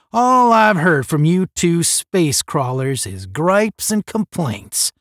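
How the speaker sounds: snarling. A narrator's reading. Cadet sick of space crawlers' griping, snarling. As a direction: lean hard and slowly into the accusation. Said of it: "snarled Roger".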